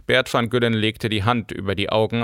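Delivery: plain reading voice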